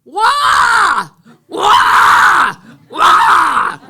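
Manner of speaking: booming high cattle-calling shout; loud, high rancher's cattle holler. Retold or imitated. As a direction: bellow across the pasture to bring the cows. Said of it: "my cattle calling voice".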